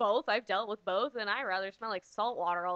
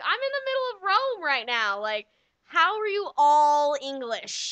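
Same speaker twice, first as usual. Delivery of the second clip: mock disbelief; 3 semitones higher, 7 dB louder than usual. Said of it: "You're like".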